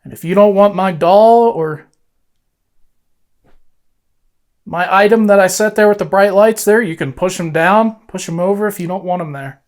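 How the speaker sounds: talking to a ghost. Real, not acted. Coaxing spirit to move doll.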